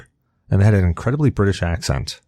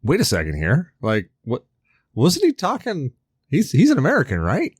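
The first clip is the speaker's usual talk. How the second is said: baffled disbelief; 5 semitones higher than usual. Acted. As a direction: act out a puzzled double take. Acted puzzled reaction to actor's accent.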